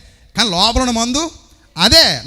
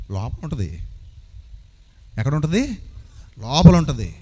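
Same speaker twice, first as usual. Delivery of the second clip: normal talking voice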